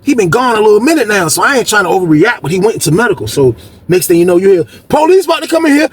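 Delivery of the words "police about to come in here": high, urgent call down the tier; pitched-up alarm call; shouted warning. Retold or imitated. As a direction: yell the heads-up to everyone. Someone calls out a warning.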